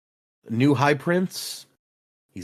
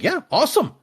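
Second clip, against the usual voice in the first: pitched-up gleeful yeah awesome; eager cheer; 13 semitones higher than usual. Retold or imitated. Dalinar cheering the challenge, pitched-up and eager. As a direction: cheer it like a brawler keen to fight. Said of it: "And I was like".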